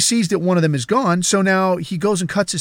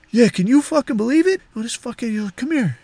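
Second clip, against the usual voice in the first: lightly acted boss, pitched up; a hint of a boss drawing someone aside; confiding boss; 6 semitones higher than usual. Boss beckons the housekeeper over, lightly voiced confiding tone.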